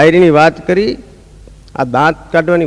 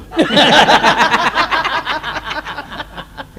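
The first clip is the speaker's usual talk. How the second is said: long rising laugh, higher voice; 9 semitones higher, 4 dB quieter than usual. Real, not acted. Comedian to audience, long laugh after punchline.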